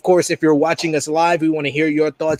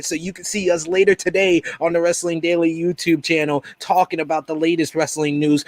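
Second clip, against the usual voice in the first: ordinary talk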